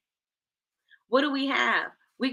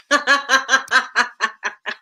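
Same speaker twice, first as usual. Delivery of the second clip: laugh; 3 semitones higher, 6 dB louder than usual. Real, not acted. Laughs at chat answer.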